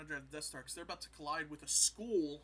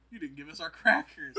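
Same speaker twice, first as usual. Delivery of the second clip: loud mock complaint; 2 semitones higher, 14 dB louder than usual. Palpatine complaining, retold.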